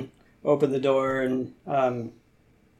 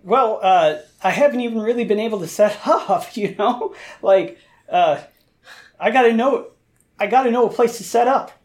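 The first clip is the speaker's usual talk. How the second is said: raised, fast flustered cook voice, pitched up; a butcher fumbling for excuses, higher and quicker; nervous cook; 7 semitones higher, 4 dB louder than usual. Acted. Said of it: "the guy with all the bags and butcher's cleavers goes".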